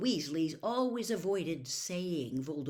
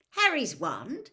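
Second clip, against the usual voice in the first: startled; 6 semitones higher, 6 dB louder, slower than usual. Acted. Fred stunned by the news.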